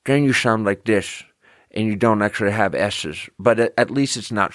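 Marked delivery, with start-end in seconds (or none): 0.1-3.3 every S slurred to SH, as in "S's"; tongue too far up, turning "sound" into "shound"; lisped S. Shown as a demonstration. Showing how the overcorrection sounds, lisped S. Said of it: "Then you sound like this".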